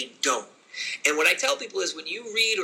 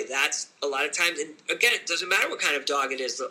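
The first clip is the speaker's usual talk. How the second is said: ordinary delivery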